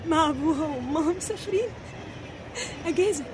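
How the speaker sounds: low, very soft telling; subdued traumatic recounting. Acted. Girl recalling a trip with her parents, subdued recounting. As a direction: recount it quietly, in halting pieces.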